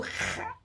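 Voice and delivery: "r" as a raspy throat sound; isolated guttural Carioca "r". Shown as a demonstration. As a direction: breathe out the "r" from the back of the throat like a Carioca. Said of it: "que o carioca fala".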